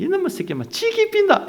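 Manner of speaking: very high Jinju-dialect scolding; mock shrieked Gyeongsang threat. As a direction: imitate a stepmother cursing in Jinju dialect. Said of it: "진주 사투리".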